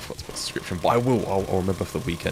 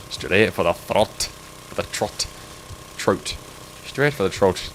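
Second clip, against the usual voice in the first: slow hammed-up Irish lilt on every word; playful Irish accent, repeating the chat line; slower than usual. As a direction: stretch it out in a mock Irish accent. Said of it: "Turned Irish".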